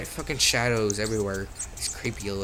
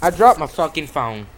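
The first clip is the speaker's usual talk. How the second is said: louder, higher outburst at the drop; loud exasperated outburst; 4 semitones higher, 10 dB louder than usual. Real, not acted. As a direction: blurt it out in real annoyance. Drops phone mid-game.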